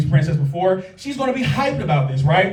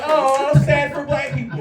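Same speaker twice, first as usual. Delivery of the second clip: mocking quote; 6 semitones higher, slower than usual. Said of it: "don't be like".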